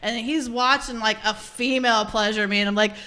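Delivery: normal talking voice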